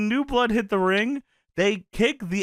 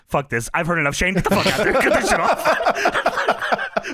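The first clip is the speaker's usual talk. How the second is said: mock angry boss barking orders, laughing; hammed-up furious order to cut it off; retelling Bischoff yelling at Shane through laughter; 2 semitones higher, 4 dB louder than usual. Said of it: "Bischoff says".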